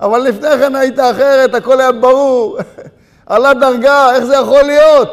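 laughing protest at starting over; mock disbelief. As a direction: throw it out loud, amused and incredulous. Mock disbelief at falling again.

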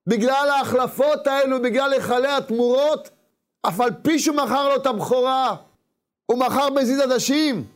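high, drawn-out sermon delivery; emphatic preaching. Real, not acted. Preacher posing a puzzle to listeners about Jacob, emphatic and high. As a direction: preach it high, stretching the key words. Preaches the question at full force.

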